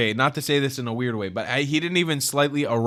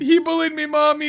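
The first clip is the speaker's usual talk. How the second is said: put-on higher, childish tattling whine; whiny kid to mom; 12 semitones higher, 3 dB louder than usual. Streamer tattles to his mom about the bully, childish whine. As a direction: tattle like a kid running to mommy.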